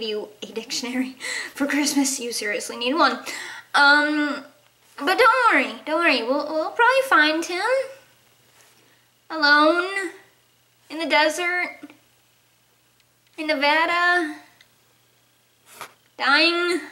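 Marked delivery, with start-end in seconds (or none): none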